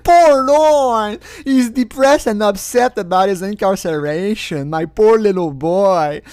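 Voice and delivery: high, slow, sing-song sarcastic pity; baby-talk sarcasm; mock pity. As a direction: coo over him mockingly. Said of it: "mocking pity".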